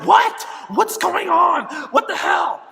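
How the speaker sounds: high, loud mom in a panic; imitated alarmed shouting. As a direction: shout it like a frantic mother, hammed-up.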